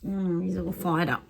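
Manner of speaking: ordinary delivery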